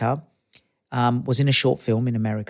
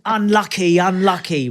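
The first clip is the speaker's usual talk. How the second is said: higher, louder touchline call; sideline consolation; 6 semitones higher, 4 dB louder, slower than usual. Retold or imitated. Imitating dads consoling kids at football, called out. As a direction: shout it across the pitch to a kid. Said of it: "dads ... going".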